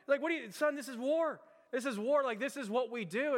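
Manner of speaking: acted angry general, pitched well up; infuriated. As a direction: scold a junior officer in mock fury. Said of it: "Cota just gets really infuriated with this thing".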